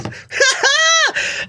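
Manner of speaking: shrieking real laugh; loud high burst of laughter. Real, not acted. Teller cracking up at the jail calls, shrieking laugh. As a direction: howl with laughter.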